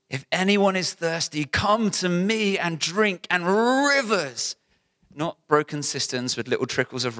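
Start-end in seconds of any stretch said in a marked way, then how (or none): 0.1-4.6 higher, slow building proclamation; impassioned preaching. Real, not acted. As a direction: lift it higher and slower as you build. Rising preaching call.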